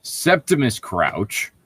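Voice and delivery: ordinary delivery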